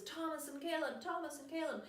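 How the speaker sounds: high calling-out at no real volume; imitated searching parents; mock yelling. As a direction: call names into the woods, held back. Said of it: "they're all yelling in the woods".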